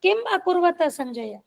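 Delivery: ordinary talk, nothing marked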